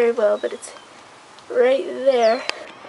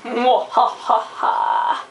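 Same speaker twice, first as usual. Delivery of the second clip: mad scientist; 2 semitones lower, much slower than usual. Pretend. Evil laugh while preparing revenge.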